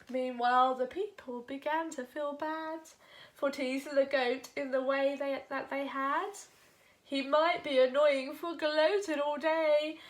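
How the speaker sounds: ordinary delivery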